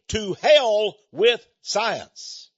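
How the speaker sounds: P=A; mocking proclamation. Mocking what opponents say.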